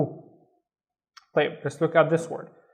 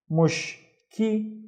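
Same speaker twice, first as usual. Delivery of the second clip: "mush, ki" as separate higher syllables; one syllable at a time; 5 semitones higher than usual. Shown as a demonstration. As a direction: break "mush, ki" apart with a clear gap. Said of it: "read it one syllable at a time".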